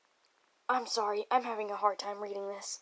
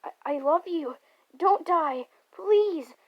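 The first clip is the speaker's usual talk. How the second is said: high, loud begging, building; desperate plea; 6 semitones higher, 11 dB louder, slower than usual. Acted. Nagito begging a dying lover to live, desperate plea. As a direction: cry out, each plea higher and louder.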